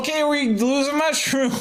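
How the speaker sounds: high, chirpy announcer voice; high host voice. Acted. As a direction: sing it out high like a cheery host. Mock cheerful game host voice.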